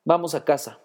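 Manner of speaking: ordinary delivery, nothing marked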